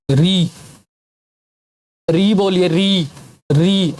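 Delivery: "री" with tongue tip curled up to the palate; "री" slow clear retroflex demo. Shown as a demonstration. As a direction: demonstrate "री" as a retroflex ri. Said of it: "री बोल के देखिए मेरे साथ".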